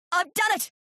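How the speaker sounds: high-pitched cry of triumph; triumphant shout. A narrator's reading. Terry holds his sea monkey jar up, triumphant shout. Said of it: "he shouted".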